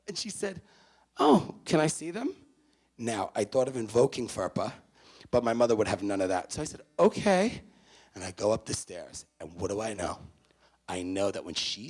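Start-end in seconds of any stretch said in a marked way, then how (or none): 1.2-2.3 mother's voice. Retold or imitated. 7.0-7.6 nervous okay. Retold or imitated. Guilty son answering mother.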